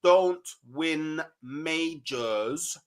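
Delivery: very slow, spelled-out delivery; drawn-out, deliberate statement; nice and slow. Real, not acted. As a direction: say it word by word with long pauses, as if for a slow listener. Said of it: "I'll say this nice and slowly for you".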